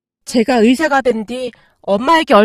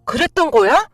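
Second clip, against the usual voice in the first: indignant; 4 semitones higher than usual. Acted. Sister scolds her brother for betraying mom, high and indignant. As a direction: tear into him with outrage.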